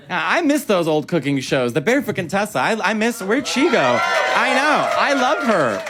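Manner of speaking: ordinary talk, nothing marked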